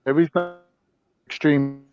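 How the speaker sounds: ordinary talk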